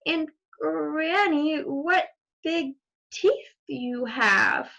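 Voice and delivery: slow, uneasy wondering; growing dread at the teeth; slow fearful little girl; a touch of fear. Acted.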